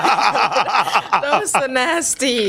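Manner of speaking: helpless laughter through the words; high, drawn-out words broken by laughter; laughing hard. Real, not acted. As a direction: crack up in embarrassed disgust. Cracking up over own burp.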